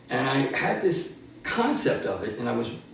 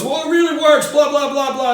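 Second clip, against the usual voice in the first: much higher, louder mom voice pleading her son's case; mom insisting; 11 semitones higher, 7 dB louder than usual. Retold or imitated. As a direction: sell it like a proud mother. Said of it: "my mom goes".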